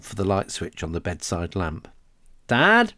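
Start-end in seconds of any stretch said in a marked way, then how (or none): 2.5-2.9 sudden high call into the dark. Acted. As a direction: call out nervously for Dad. Boy calls out in dark.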